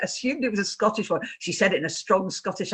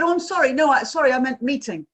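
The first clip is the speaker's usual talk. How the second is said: higher, flustered correction; hasty apology; 6 semitones higher, 4 dB louder than usual. Retold or imitated. Son correcting himself to parent on phone, apologetic. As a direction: stumble into an apologetic correction, pitched up.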